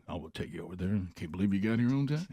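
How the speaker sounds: low, quiet, laid-back voice; Dan. Retold or imitated. Driver offers a ride over, low laid-back voice. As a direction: imitate an easygoing radio man, soft and deep. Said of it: "nobody more laid back than Dan".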